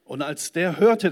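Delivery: normal talking voice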